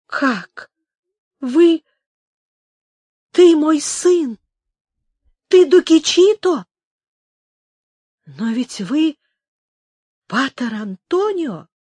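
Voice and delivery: halting astonished questions, rising high and loud; breaking, trembling exclamation. A narrator's reading. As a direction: burst out in disbelief, word by word. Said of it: "прерывающимся дрожащим голосом".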